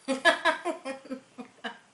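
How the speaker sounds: loud high laugh; burst of laughter. Real, not acted. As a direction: crack up laughing. Reacting to the video joke.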